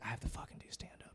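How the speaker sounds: retold grumble, almost whispered; quick, voiceless grumble under the breath; unenthused mutter. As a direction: imitate a comic muttering reluctantly. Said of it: "didn't seem, like, in the mood".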